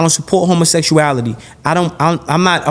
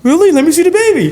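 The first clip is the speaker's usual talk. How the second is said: high, eager, louder fake-friendly monster; mock eager Dracula; 12 semitones higher, 4 dB louder, faster than usual. Dracula pretending interest in the newborn, high eager mock voice. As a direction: feign delight to lure the prey.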